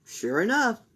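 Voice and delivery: ordinary delivery, nothing marked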